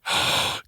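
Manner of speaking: soft breathy imitated gasp of shock; imagining fans reacting to his hair, mock gasp. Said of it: "everyone's gonna be like".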